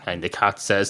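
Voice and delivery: ordinary talk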